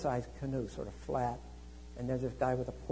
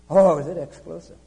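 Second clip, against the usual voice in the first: mock surprise; 3 semitones higher, 15 dB louder than usual. John's startled reply.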